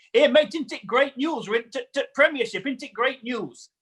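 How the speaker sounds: put-on a thrilled local fan in a Yorkshire accent; Huddersfield accent. Football fan rushing out of a bar, thrilled Huddersfield accent. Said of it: "That is a fantastic Huddersfield accent".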